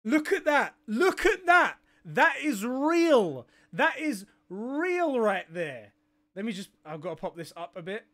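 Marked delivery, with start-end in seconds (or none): 2.0-5.9 slow, each word landed with weight; slow emphatic. Real, not acted. Streamer staring at the official post, slow and emphatic. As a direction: savour every word, slowly.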